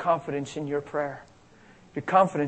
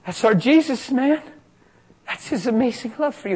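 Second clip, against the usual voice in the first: joyful exclamation; 8 semitones higher than usual. Retold or imitated. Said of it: "I looked at him and I said".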